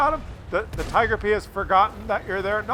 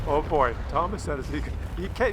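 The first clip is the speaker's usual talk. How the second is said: usual speaking voice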